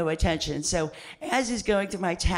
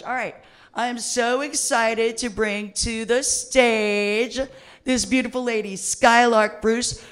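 emcee introduction; 4 semitones higher, 5 dB louder than usual. Acted. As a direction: bring the act on like an emcee. Host introducing next comic.

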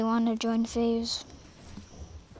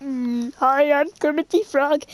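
Kermit the Frog voice. Pretend. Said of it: "I will do a Kermit the Frog voice".

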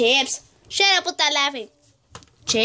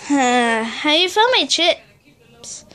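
normal talking voice

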